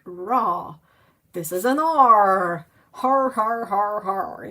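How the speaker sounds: loud, slow, hammed-up sounding out; mock loud reading. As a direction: bellow each syllable like a clueless reader. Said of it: "they go like".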